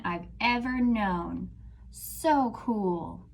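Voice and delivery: expressive storybook reading; reading to children. Acted. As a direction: read it out like a picture book to a class. Teacher reads picture book aloud.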